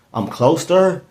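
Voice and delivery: mock disbelief with a touch of fear; surprised, somewhat fearful. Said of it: "the surprise and the kind of fear".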